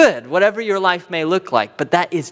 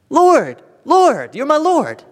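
much higher, earnest cry of Lord, Lord; pleading false believer; 10 semitones higher, 4 dB louder, slower than usual. Retold or imitated. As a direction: plead like someone sure they're saved.

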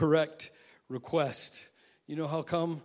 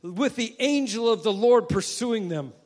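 psalm cried out; 7 semitones higher, 6 dB louder than usual. Retold or imitated. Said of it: "when he cries out".

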